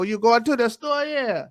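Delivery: put-on teasing the wife's family with a New Orleans accent; imitating New Orleans in-laws. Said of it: "they go".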